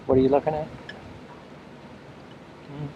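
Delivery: normal talking voice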